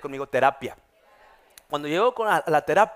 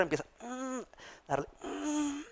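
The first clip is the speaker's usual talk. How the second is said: higher, pinched mock groans; groan of pain; 10 semitones higher, 10 dB quieter than usual. Pastor mimics groaning as the therapist works the spatula. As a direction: strain through the pain.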